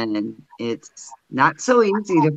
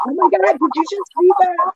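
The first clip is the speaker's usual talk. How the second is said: very high-pitched mock gasp of disbelief; imitated astonishment. Retelling Boomer's reaction to the red orb, imitated astonishment. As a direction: imitate a startled witness in a squeaky-high register. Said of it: "Boomer stuck his finger out and said".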